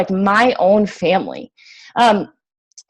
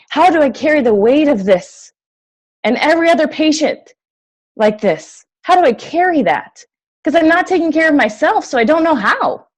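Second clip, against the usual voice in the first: pitched higher, pleading with herself; a raised, desperate lament in her own voice; anguished inner question; 6 semitones higher than usual. Retold or imitated. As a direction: ask it like you're at a breaking point. Recalled despair after patient's death.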